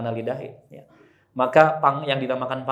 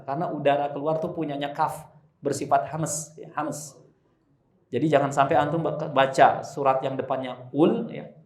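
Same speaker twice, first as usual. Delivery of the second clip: ordinary talk, nothing marked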